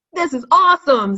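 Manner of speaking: put-on a thrilled kid admiring the finished rainforest; excited. A narrator's reading. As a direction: burst out with delight at the result. Kids delighted with finished project.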